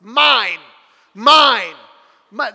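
put-on loud possessive toddler yell; three-year-old's shout. Three-year-old refusing to share, shouting. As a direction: punch each word out like a greedy toddler. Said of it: "When you're screaming".

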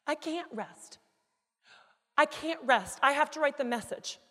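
high, loud, emphatic refusal to stop; convicted insistence. Retold or imitated. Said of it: "I was convicted".

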